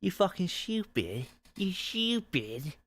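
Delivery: high, hammed-up British yob; mock British accent. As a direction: scold in an exaggerated, high-pitched British accent. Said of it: "A British person is like".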